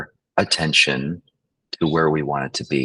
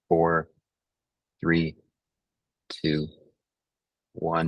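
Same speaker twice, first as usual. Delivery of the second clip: measured breathing count with long pauses; guided breathing. Acted. Counts them through breaths.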